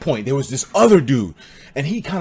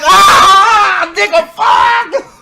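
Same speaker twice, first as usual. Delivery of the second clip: much higher, loud shocked shout; startled yell; 7 dB louder, much slower than usual. Retold or imitated. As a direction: yell in horror, half laughing. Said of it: "I was like".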